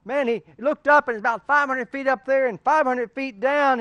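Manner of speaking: preacher's dramatic storytelling; building story tension. Acted. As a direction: tell it with rising suspense.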